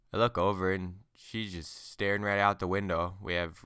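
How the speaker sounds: ordinary delivery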